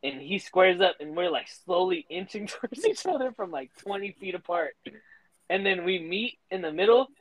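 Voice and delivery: usual speaking voice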